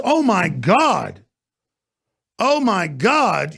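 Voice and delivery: slow, drawn-out sarcastic dismay; mock horror. Host scoffing at booing as warfare, slow mock horror. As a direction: stretch each word in feigned disbelief. Feigned horror at being booed.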